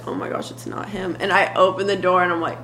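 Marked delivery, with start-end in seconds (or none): 0.1-1.2 whispered, nervous hush. Retold or imitated. Sister hears a knock, whispering in nervous hope. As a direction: breathe it out in a whisper.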